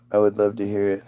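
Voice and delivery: ordinary talk, nothing marked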